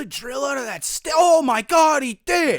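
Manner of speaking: high-pitched mounting disbelief; hammed-up awestruck viewer; mock amazement. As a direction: build to a loud astonished yell.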